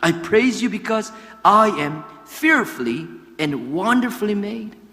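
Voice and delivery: slow, deliberate English with a Korean accent; English quotation. Shown as a demonstration. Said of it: "영어로 보면은".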